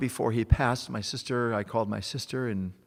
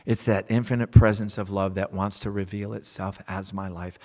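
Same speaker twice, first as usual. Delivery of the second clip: ordinary talk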